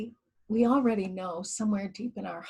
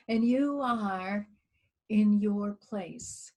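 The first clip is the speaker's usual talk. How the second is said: guided meditation. Acted. Leading listeners in a guided earth meditation, calm and hushed. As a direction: ease listeners inward, letting phrases fade to near whisper.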